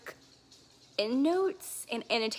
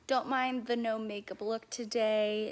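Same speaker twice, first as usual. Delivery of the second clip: ordinary talk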